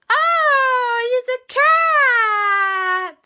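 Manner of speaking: very high, very slow drawn-out oh; exaggerated surprise. Shown as a demonstration. Children meeting the cat witch, in deliberately overdone surprise. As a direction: overplay the shock on purpose. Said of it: "They didn't say it like that".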